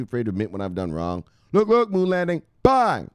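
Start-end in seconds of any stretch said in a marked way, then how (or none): 1.5-3.1 high, punchy announcement; high hype-man burst; hyped shout-out. Pretend. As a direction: call it out and land the last word hard. Calling out a follower.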